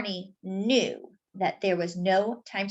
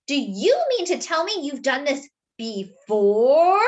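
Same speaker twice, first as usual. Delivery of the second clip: horrified; 4 semitones higher, 5 dB louder, slower than usual. Acted. Arnie horrified question.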